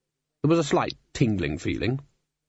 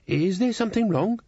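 much higher, timid question; slightly trembling maid; 10 semitones higher than usual. A narrator's reading. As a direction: ask anxiously, as a nervous servant. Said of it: "trembling slightly".

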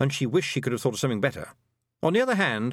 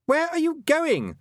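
high, drawn-out shout; boy shouting; 4 dB louder than usual. A narrator's reading. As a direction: call after someone running away, pitched high. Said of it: "shouted Roland behind her".

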